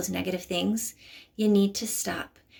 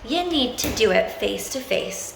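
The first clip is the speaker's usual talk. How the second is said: normal talking voice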